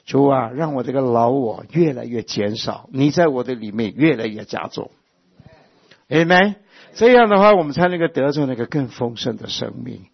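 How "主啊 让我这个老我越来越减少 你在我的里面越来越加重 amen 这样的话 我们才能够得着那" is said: fervent preaching. Acted. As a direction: build the prayer into a shout of Amen. Fervent prayer then Amen.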